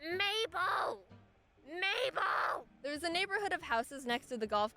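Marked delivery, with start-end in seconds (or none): 0.0-2.7 calling from afar. Retold or imitated. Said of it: "we hear from across the lake".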